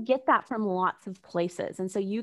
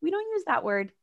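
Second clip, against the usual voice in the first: gentle parent; 7 semitones higher than usual. Retold or imitated. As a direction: coax the little one kindly, a touch higher. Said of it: "you just kinda gently".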